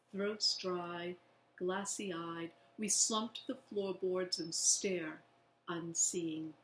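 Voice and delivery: plain reading voice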